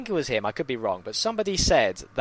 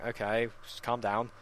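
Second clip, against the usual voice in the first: unexcited; 7 dB quieter than usual. Retold or imitated. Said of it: "everyone's there getting excited".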